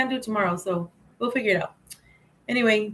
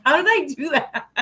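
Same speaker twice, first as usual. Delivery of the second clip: laughing amazement; 5 semitones higher than usual. Retold or imitated. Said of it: "I was like".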